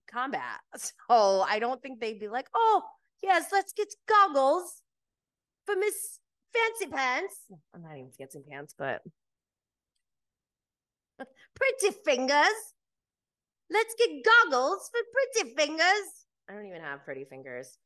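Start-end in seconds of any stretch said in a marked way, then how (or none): 2.5-7.3 high, loud mock-obliging voice; mocking fawning. Imagined staff fussing over goggles for a pampered recruit, mocking. 11.5-16.2 high, loud mock-obliging voice; mocking fawning. Imagined staff fussing over goggles for a pampered recruit, mocking.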